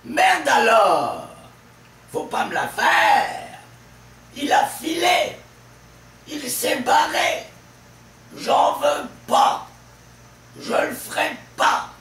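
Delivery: shouted exclamations, endings "lors", "faire", "lé", "ré", "pas" hammered; high, loud outbursts landing hard on the final "pas"; stress on last syllable. Shown as a demonstration. Teacher demonstrating stress in common expressions, shouting final "lors", "faire", "pas". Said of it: "le stress est toujours sur la dernière syllabe".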